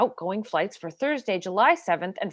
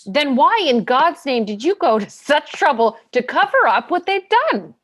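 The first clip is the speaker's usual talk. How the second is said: louder and rising higher to the end; angry accusation; 3 semitones higher, 6 dB louder than usual. Acted. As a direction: throw the accusation in his face. Lawyer's climactic accusation in court.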